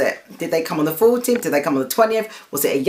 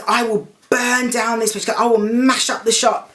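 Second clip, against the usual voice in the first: higher heated mock threat; imitating her threats; 6 semitones higher than usual. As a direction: replay the shopkeeper's wild threat with a raised voice. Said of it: "She goes".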